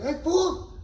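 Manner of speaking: high, loud call across the street. Retold or imitated. Gangbanger hailing a stranger from across the street, high and loud. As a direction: yell it over to the other side.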